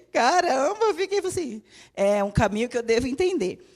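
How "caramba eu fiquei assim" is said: higher, slowed by real laughter; real amused laugh while talking. Real, not acted. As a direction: giggle through the words.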